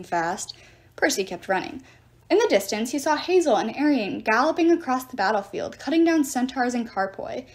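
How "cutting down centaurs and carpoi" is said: ordinary delivery, nothing marked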